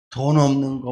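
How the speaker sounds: usual speaking voice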